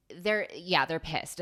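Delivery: normal talking voice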